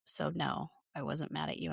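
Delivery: low, soft icy put-down, mildly imitated; hint of a chilly warning; cold quiet retort. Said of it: "And Sutton says".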